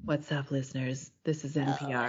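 low, sultry radio greeting; sexy low voice. Acted. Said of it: "the sexy voice on this recording".

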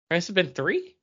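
normal talking voice

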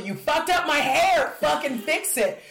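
high, loud, slow angry plea; imagined angry outburst. Retold or imitated. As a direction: spit it out slowly at her. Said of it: "I can't tell her".

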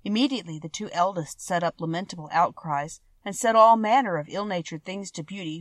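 ordinary delivery

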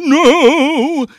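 long, high, very loud wail; drawn-out scream of dismay. A narrator's reading. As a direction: scream it out in horror. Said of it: "The prince screamed".

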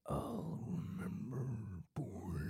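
menacing demon growl. Acted. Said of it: "give warning of the demon voice".

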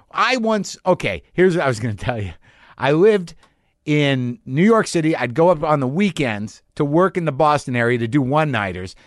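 ordinary talk, nothing marked

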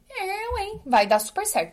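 high, mocking Eu, hein?; incredulous scoff. Real, not acted. Mocking the idea of magic techniques, high incredulous scoff. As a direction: scoff at it like it's absurd.

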